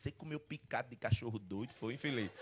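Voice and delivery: low, quiet reproach, acting the fiancée; low scolding. Fiancée confronts him over the shopping bag, low scolding.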